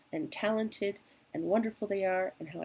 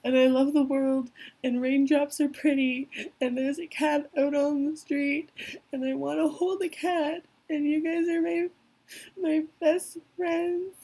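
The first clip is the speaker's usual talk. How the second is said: high, loud, choked-up gushing; hammed-up weepy outburst of affection; overwhelmed tearful gush; 8 semitones higher, 11 dB louder than usual. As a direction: pour out love through sudden tears, much higher and louder. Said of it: "And I'm like".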